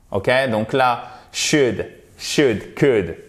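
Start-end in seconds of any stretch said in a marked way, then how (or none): 1.3-3.2 "should, should, could" with short vowels. Shown as a demonstration. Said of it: "une voyelle courte".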